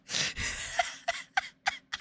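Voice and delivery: high, breathless wheeze of laughter; silent, wheezing real laugh. Real, not acted. Cracking up at own joke.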